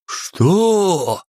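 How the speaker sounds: high startled shout; shocked outcry. A narrator's reading. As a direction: jolt up in shock. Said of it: "вскинулись все иерархи разом".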